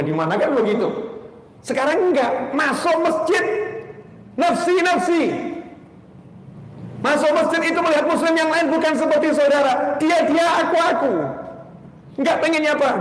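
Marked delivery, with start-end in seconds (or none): 1.6-13.0 impassioned rebuke. Acted. Preacher decrying cold mosque-goers.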